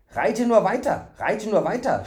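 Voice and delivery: high, loud panting call after a run; breathless call. A narrator's reading. Fool running after the stranger, breathless call. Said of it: "rief er keuchend".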